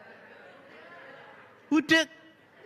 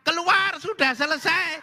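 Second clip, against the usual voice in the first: higher, sharp order; priest snaps; 7 semitones higher than usual. Acted. Deaf priest ordering husband out, sharp. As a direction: bark him out of the booth. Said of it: "romonya bentak".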